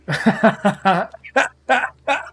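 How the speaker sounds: sudden high burst of laughter, cracking up. Real, not acted. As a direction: break out laughing at the chat. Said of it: "don't make me laugh like that".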